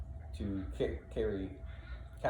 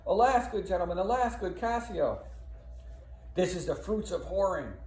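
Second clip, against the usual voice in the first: mock lament; 9 semitones higher, 3 dB louder than usual.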